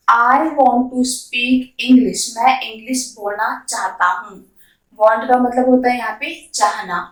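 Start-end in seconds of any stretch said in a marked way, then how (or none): 0.1-2.3 the whole sentence at a slow, even teaching pace; slow classroom model; slow full sentence. Shown as a demonstration. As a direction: say it smoothly and slowly in one breath. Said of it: "full sentence repeated".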